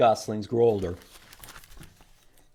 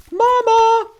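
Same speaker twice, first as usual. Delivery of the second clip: put-on very high, loud baby bird call; gosling cry; 11 dB louder than usual. A newborn gosling crying out for its mama. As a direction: squeal it like a hatchling.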